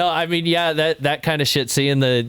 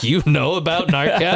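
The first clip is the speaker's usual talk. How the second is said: higher-pitched mock disbelief, half laughing; astonished reply; 7 semitones higher than usual. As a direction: retell your amazed question through a laugh.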